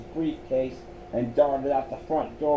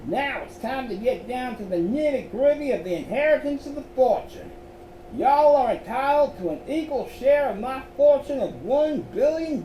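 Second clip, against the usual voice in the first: put-on higher Southern-accented gentleman on a record; 10 semitones higher than usual. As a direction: boom it out like an old Southern gentleman. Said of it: "equipped with a southern accent".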